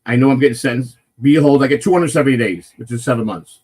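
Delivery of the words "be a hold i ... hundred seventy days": usual speaking voice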